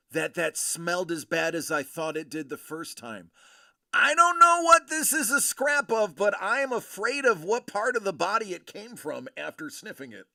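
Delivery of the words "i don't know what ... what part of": disgusted outburst. Real, not acted. Disgust after sniffing.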